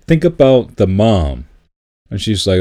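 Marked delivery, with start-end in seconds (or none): none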